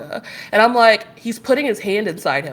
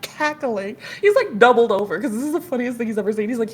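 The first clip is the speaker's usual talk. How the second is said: usual speaking voice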